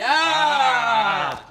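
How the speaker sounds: high, loud stage yell; startled shout. Acted. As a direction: yell as the shield hits. Shield falls.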